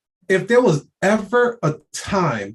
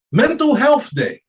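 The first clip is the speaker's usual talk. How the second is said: Mental Health Day pitched way up in disbelief; mock disbelief, much higher; incredulous repeat; 9 semitones higher, 4 dB louder than usual. Shown as a demonstration.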